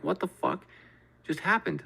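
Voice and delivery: high-pitched baffled outburst; tripped-out disbelief. Retold or imitated. Telling friends about a baffling chair sale, in high disbelief. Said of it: "I'm just tripping out".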